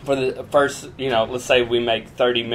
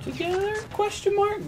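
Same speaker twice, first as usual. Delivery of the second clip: mock questioning; 6 dB quieter than usual. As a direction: question mark squeaked way up. Joking he sleeps with friend.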